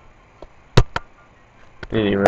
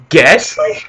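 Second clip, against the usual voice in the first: surprised; 7 semitones higher, 6 dB louder than usual. Real, not acted. Spotting a Pokemon in game.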